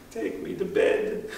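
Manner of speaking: put-on high, breathless whisper of a spent old man; an old king, worn out, asking Achilles for sleep; exhausted whisper. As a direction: whisper it, all strength gone. Exhausted Priam asks for rest.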